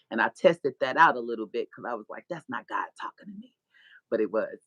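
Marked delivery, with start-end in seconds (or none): 2.3-3.5 whisper. Retold or imitated. Doubting inner voice.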